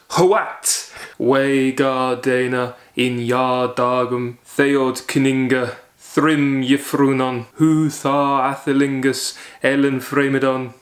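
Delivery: put-on slow, declaiming Beowulf in Old English; Old English recitation. Shown as a demonstration. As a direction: proclaim "Hoat!" then chant the lines slowly. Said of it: "I can't pronounce Old English at all".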